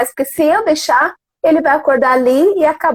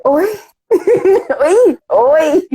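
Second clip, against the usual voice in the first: surpresa incrédula; 3 semitones higher, much slower than usual. Retold or imitated. Mãe ouve a crítica do filho.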